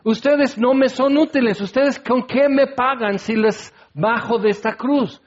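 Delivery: un Jesús imaginado que regatea, un poco más agudo; mock mercenary Jesus. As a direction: regatea el precio con sorna. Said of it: "Jesús podría decir". (Jesús imaginado regateando con los ladrones, en tono burlón)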